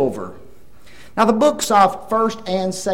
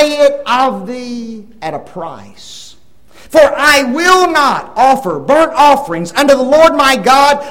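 louder, measured King James reading; scripture reading; 5 semitones higher, 8 dB louder, slower than usual. Acted. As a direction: proclaim the king's words solemnly. Reads King David's words aloud.

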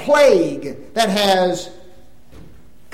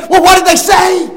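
wife asking; 11 semitones higher, 12 dB louder than usual. Retold or imitated. Wife asking in retold dialogue.

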